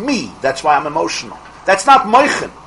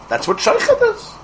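a much higher, squeaky take on "sheikhad". Shown as a demonstration. Rabbi repeating the key word to the class in a high squeak. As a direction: toss "That's what sheikhad is" up into a high squeak.

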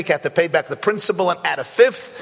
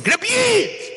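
screaming owner; 14 semitones higher than usual. Retold or imitated. Vineyard owner yelling from the porch at the tourist, screaming. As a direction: scream it at him like an outraged farmer. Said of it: "starts screaming".

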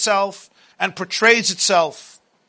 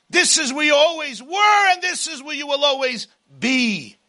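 booming motivational speech; 6 semitones higher than usual. Acted. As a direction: rail at the runaway slave. Pharaoh voice accusing.

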